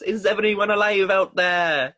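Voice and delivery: shouted Welsh call into the dark; Welsh accent. Retold or imitated. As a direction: Welsh accent, high and loud. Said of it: "I'm obsessed with your Welsh accent".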